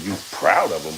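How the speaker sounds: usual speaking voice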